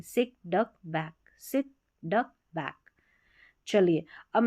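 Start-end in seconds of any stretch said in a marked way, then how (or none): 0.0-2.7 सिक, डक, बैक said softly with short vowels; soft, unforced सिक डक बैक, each word separate; without pressure. Shown as a demonstration. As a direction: let सिक, डक, बैक drop out short and light. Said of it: "यहाँ पर इतना pressure की ज़रूरत नहीं पड़ रही".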